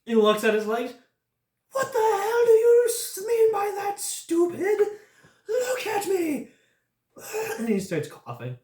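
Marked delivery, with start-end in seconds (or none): 1.7-6.5 much higher, louder, crying out at the stranger; pained angry yell. Acted. Dying bandit yells at the adventurer, crying shout. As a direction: bellow through tears of pain.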